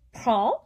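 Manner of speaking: PREND ending on the nasal vowel, no D; silent final D. Shown as a demonstration. Teacher giving an example word, silent final D. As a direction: leave the D off prend. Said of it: "don't hear the D".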